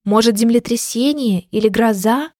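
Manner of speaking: plain reading voice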